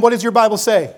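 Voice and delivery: ordinary talk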